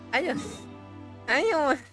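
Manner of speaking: normal talking voice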